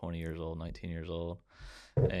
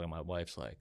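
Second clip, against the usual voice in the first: ordinary talk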